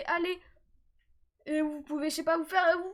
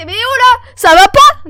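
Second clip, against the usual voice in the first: loud, much louder outburst; exasperated shout; 6 semitones higher, 21 dB louder, slower than usual. Real, not acted.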